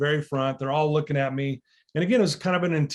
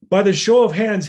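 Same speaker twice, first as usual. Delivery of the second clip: raised, higher call to a crowd of inmates; calling out to the room; 5 semitones higher, 8 dB louder than usual. Retold or imitated. Speaker calls out to a hundred and twenty inmates, loud and high. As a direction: project it to the back of the gym.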